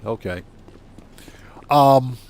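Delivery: normal talking voice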